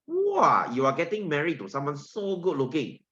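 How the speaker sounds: a squealing wow, high then settling; excited girl. Retold or imitated. Girl thrilled to marry handsome potassium, squealing excitedly. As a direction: gush like a thrilled bride. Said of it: "can you see the girl very excited".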